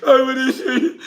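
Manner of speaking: high, loud blubbering whine with laughing sobs; hammed-up weeping man; mock crying man. Broke man crying on his woman's shoulder, mock sobbing.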